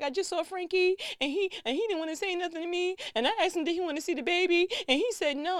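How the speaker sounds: high, loud, rushed upset voice; retold crying. Daughter crying to her mother about her brother, high and rushed. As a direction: tearfully blurt it all out to mom. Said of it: "I burst down crying".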